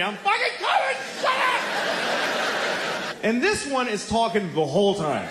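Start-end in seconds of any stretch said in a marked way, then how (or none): 0.0-3.2 much higher frantic yell. Retold or imitated. Dad yelling at his phone in pocket, frantic high yell. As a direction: bark it into your pocket at your wife. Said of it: "I'm yelling into my pocket".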